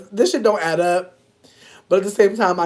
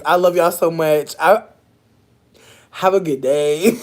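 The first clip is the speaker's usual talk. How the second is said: ordinary delivery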